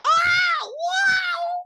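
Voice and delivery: high, loud cartoonish wail; hammed-up horror victim howl; mock wailing. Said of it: "she's just like".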